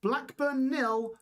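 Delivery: usual speaking voice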